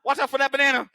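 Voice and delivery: mock warning. Friend joking about the robot slipping, mock warning. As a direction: warn him playfully. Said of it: "joke warning".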